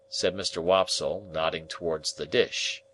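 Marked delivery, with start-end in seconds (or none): none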